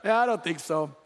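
put-on cocky kid refusal; lightly imitated toddler; smug toddler again. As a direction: repeat it smugly. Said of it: "and goes".

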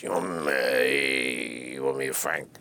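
slow, drawn-out gruff old-club-boss impression; imitating Jules Padel. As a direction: stretch every word like the old Copa host. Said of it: "Jules Padel talked like this".

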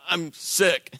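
faking being sick, a little weak; mock sick voice; fake sick voice. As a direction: play it like a fibbing sick excuse. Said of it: "Little lie".

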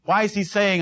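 usual speaking voice

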